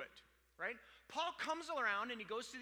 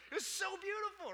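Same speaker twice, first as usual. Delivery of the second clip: high, louder, overcome squeal; mock tearful gushing; 12 semitones higher, 5 dB louder than usual. Preacher recalling crying on the plane, mock tearful. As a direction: gush in a high voice as if moved to tears.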